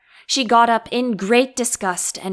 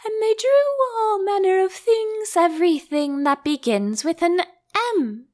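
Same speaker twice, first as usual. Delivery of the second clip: sleepy dormouse; 7 semitones higher than usual. A narrator's reading. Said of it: "it was getting very sleepy".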